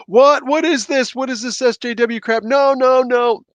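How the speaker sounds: high, loud mock outrage; outraged fans. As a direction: cry out in high-pitched, hammed-up disbelief. Acts out fans' outraged reaction.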